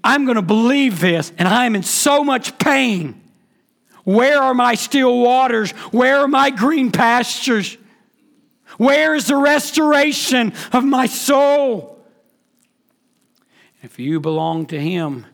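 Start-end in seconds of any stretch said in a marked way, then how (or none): 0.0-12.0 anguished plea. Acted. Hurting believer crying out to God, anguished rising plea. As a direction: plead with God from the hurt, climbing higher each question. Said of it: "The hard part is saying".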